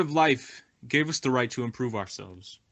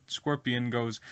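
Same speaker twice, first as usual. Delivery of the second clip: usual speaking voice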